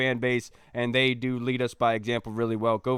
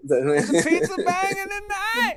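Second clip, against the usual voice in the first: very high, drawn-out joke cry; hammed-up squeal; 4 dB louder, slower than usual. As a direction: squeal it out slowly in mock excitement. Sudden joking high-pitched outburst.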